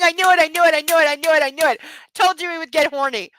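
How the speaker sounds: loud, high gloating shout; triumphant shout. Real, not acted. Reader gloating her prediction came true, loud triumphant shout. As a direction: crow it in triumph, fading.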